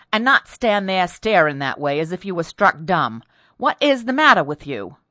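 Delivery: loud, insistent dressing-down; peremptory scolding. A narrator's reading. Mistress scolds her dumbstruck maid, peremptory and loud. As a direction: scold forcefully, driving the question home. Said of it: "who could be peremptory".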